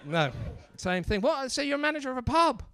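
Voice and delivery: ordinary talk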